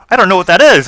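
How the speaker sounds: high, loud, bubbly gnome greeting; eager gnome. Acted. Gnome wizard greets the party, high and overeager. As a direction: chirp it out like an overexcited little gnome.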